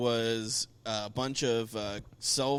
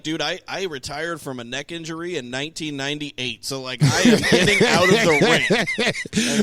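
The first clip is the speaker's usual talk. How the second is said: mock the old man ducking out; playful impression climbing into a laughing shout; hammed-up Arn; 4 semitones higher, 11 dB louder than usual. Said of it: "Arn's like".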